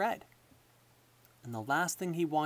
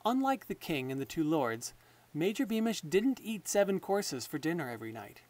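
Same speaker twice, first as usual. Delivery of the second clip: ordinary delivery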